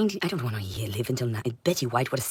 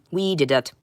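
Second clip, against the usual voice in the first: ordinary delivery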